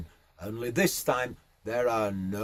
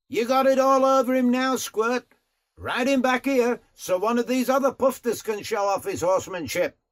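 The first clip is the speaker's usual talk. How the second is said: high, loud call across the paddock; rough horseman calling the rider back; pleased Aussie riding boss; 10 semitones higher, 4 dB louder than usual. Acted. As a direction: holler approval, then bossy instructions.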